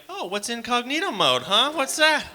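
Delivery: high, loud fake-naive voice; mock innocent. Comic mocking people who pretend not to know incognito mode, high and fake-innocent. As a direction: play dumb in a squeaky voice.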